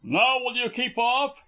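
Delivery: insistent shouted question, pitched far up; demanding. A narrator's reading. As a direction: demand that they back away. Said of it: "he demanded".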